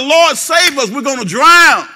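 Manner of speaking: frantic cry. Retold or imitated. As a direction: shout it like sinking in a boat. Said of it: "they cried out".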